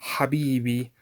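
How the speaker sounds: "habibi" with a breathed throaty H; Arabic pronunciation. Shown as a demonstration. Teacher showing a famous Arabic word, breathed H. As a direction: breathe the first sound of "habibi". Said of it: "in Arabic".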